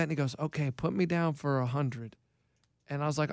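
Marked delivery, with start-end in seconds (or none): 0.4-2.2 low, soft, deadpan coach impression; Keith. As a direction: imitate an older friend's low, quiet phone voice. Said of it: "Well, Keith".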